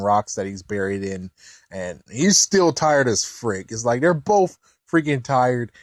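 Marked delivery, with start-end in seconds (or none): none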